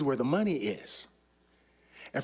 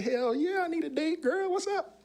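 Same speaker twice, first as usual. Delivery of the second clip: rising, much higher eager reply; eager hell yeah; 12 semitones higher than usual. Retold or imitated. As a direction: climb higher with excitement on each phrase. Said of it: "I said".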